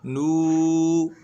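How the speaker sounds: नू with the oo held very long; long drawn-out vowel. Shown as a demonstration. Teacher showing the wav madd, नू drawn out long. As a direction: stretch the vowel of नू steadily. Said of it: "इसको खींचिए कि वाव मद्दा है".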